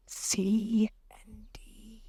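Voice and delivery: slow, soft whisper. A narrator's reading. Alice whispering to the touchy mouse. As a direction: whisper it half afraid. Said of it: "she added in a whisper".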